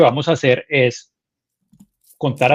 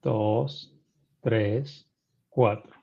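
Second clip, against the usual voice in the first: slow, soft, lower meditation count; guided breathing count; 4 semitones lower, 7 dB quieter, much slower than usual. Acted. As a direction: count each breath calmly and softly.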